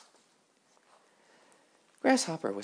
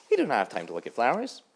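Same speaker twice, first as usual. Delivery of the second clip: loud, high, boastful dragonflies; brash dragonfly voice; 5 semitones higher, 10 dB louder, faster than usual. Acted. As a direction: call out high and loud, showing off.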